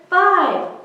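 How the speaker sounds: ordinary delivery, nothing marked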